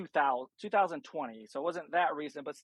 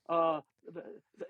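normal talking voice

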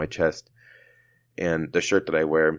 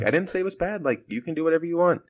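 high-pitched backpedalling, at normal volume; retold placating; 11 semitones higher, faster than usual. Telling the old patient his whiskey is fine, high retold placating. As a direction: imitate yourself hastily reassuring, pitched way up.

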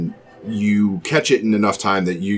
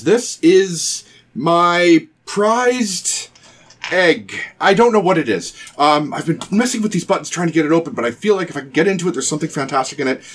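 boastful egg owner in character; 7 semitones higher than usual. Acted. Game master plays the egg's proud owner.

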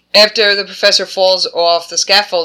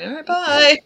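much higher, offhand farewell; breezy mock goodbye; 10 semitones higher than usual. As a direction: toss it off like nothing matters. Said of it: "he's like".

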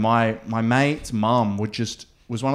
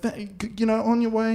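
high eager send-off; 10 semitones higher, 4 dB quieter than usual. Retold or imitated. Naive teen self voiced.

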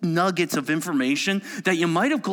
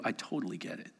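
gentle reassurance; 9 semitones lower, 14 dB quieter, faster than usual. Acted. Jesus answering the struggling believer.

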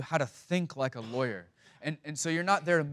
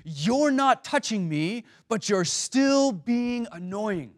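put-on exasperated kid; 7 semitones higher, 5 dB louder, slower than usual. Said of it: "what he wants to say".

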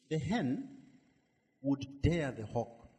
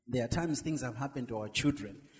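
normal talking voice

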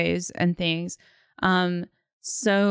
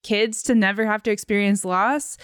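ordinary talk

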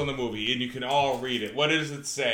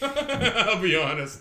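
laughing; 7 semitones higher, 4 dB louder, much slower than usual. Real, not acted. Laughs after final thoughts.

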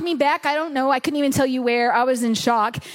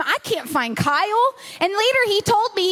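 usual speaking voice

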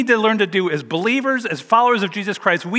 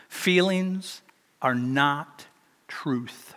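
feelings, are, not, truth, each word set apart and softer; emphatic, spaced out; 4 semitones lower, 6 dB quieter, much slower than usual. Real, not acted. Pastor driving home the sermon's point, slow and deliberate. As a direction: let each word land on its own. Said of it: "slowed emphatic key line".

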